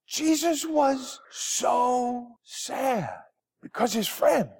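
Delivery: storybook sadness, slow and high; mock sad. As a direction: draw out the sadness for kids. Children's sermon, sad Jesus.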